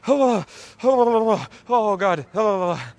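high, loud shocked gasping; cold-shower shock. Acted. Likening rejection to going into a cold shower, shocked gasps. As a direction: gasp as icy water hits you. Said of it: "like going into a cold shower".

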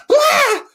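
high, loud "blah" like a haunted-house goblin; mock monster scare. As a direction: jump out with a spooky yell. And they come out.

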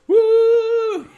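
high, loud, drawn-out whoop. Retold or imitated. Host quotes film riddle about the singer, loud high whoop. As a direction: let out a long falsetto woo.

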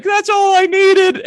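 hammed-up relief of the crying coworker; relieved outburst. Crying coworker to her comforter, hammed-up relieved cry. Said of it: "And she's like".

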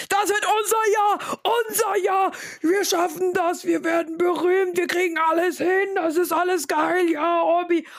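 high-pitched, hyped-up yell without full volume; re-enacted yelling. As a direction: replay screaming a rousing pep talk at a friend. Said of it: "Ich hab Orbi angeschrien".

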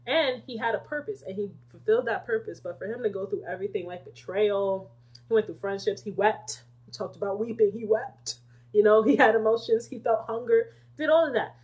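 ordinary talk, nothing marked

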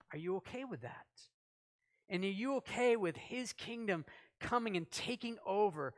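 ordinary delivery

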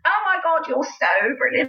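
high gushing praise; imitating a gushing audience member; gushing fan. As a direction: gush like an excited fan. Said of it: "people would come up".

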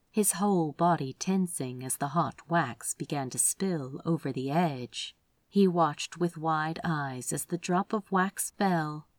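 ordinary delivery, nothing marked

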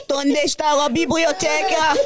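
mock Spanish.

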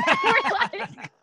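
high, slow, laughing and crying at once; half-laughing, half-sobbing as the story comes out; real tearful laughter; laughing through tears. Real, not acted. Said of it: "crying and I can't stop".

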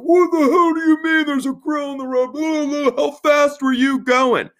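very high, frantic exasperated rant; hammed-up outraged father on the phone; mock irate dad. Dad reacting to a grill in the road, mock outrage. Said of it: "So he's not thrilled".

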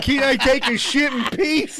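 high, drawn-out whine of outrage; mock exasperated protest. Caught man protests to the Italian lady, high mock outrage. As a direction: plead indignantly, voice pushed way up.

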